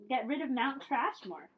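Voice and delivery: usual speaking voice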